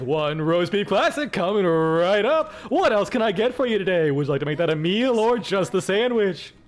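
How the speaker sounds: showy host voice, stretched words; talk show host. Shown as a demonstration. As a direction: take the order like a game show emcee. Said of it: "put on talk show host voice".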